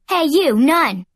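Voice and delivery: loud call across the yard; yelling. A narrator's reading. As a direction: call out loudly to get attention. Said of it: "she yelled at one of the".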